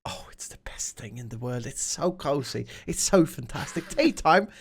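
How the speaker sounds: gushing, hammed-up British tea lover; mock British delight, savouring every word; British accent. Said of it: "an actual British person".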